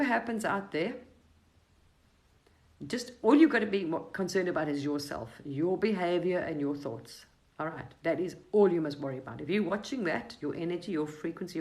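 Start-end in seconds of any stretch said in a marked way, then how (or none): none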